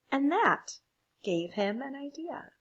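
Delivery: ordinary delivery, nothing marked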